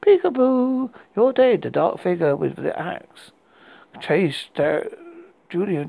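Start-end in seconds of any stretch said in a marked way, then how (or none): 0.0-1.6 put-on high, loud eerie child chant; sing-song it like a ghost; creepy little girl. A narrator's reading. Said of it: "and what said".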